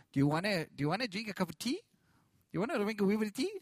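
a light, quick Northern Irish lilt; imitating Irish accent. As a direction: tease the Irish way of offering tea, quickly. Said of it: "all got these beautiful accents".

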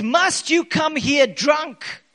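a priest shouting at a praying woman, angrily; demanding shout. Acted. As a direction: bark it at her like a stern priest. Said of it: "he demanded".

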